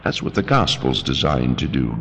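ordinary talk

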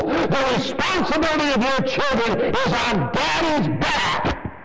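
high, ringing pulpit preaching; fiery revival preaching; full-force preaching. Acted. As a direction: hammer the point from the pulpit.

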